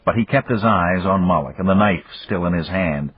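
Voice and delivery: ordinary delivery, nothing marked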